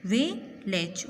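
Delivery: "Village" slowly as वि, then लेज; a clear gap inside "village" between its two syllables; split into syllables. Shown as a demonstration. Teacher showing students how, "village" in two slow beats. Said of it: "किस तरह?".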